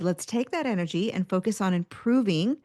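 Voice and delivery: usual speaking voice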